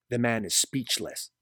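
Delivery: ordinary delivery, nothing marked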